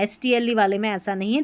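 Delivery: ordinary talk, nothing marked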